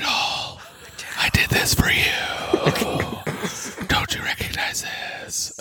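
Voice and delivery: put-on breathy whispered old politician; Joe Biden whisper. Cohost jokingly impersonates a whispering Joe Biden. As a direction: whisper it close and intimate, mock Biden. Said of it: "And he whispers".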